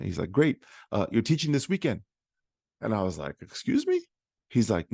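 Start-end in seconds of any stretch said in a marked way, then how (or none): 0.3-2.0 a hint of spunky high-pitched pep; John. Voices the spunky mentor springing news. 3.4-4.0 squeaky, incredulous question; acted disbelief. Acts out his shocked reaction.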